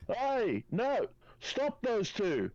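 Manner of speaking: drawn-out, high cry of alarm; Leslie Hunter yelling. Acted. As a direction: call out high to halt runaways. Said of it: "Leslie Hunter yell".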